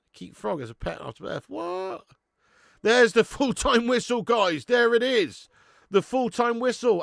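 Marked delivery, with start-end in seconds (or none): 1.5-2.0 sudden high disbelieving squeak; surprised. Real, not acted. Streamer reading a wild frog fact, surprised squeak. 2.8-7.0 higher and louder, slow announcer call; excited call. Real, not acted. Streamer calling full-time to his viewers, excited.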